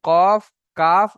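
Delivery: কফ, কাফ drawn out very slowly and loud, each letter separate; slow letter demo. Shown as a demonstration. Teacher contrasting qaf and kaf for students, slow loud demo. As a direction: stretch কফ and কাফ slowly and loudly side by side. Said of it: "ছ নম্বর".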